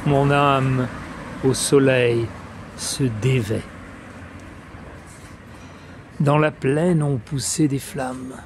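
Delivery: solemn verse reading with long pauses between phrases; declaimed French poem, line endings falling away; poetry recitation. Acted. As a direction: recite it phrase by phrase, letting each image resonate. Recites Apollinaire's Le Brasier.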